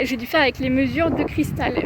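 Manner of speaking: ordinary delivery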